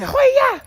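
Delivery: very high, loud martial-arts cry; kung fu shout. Shown as a demonstration. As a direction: yell it like a karate kick. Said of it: "you say".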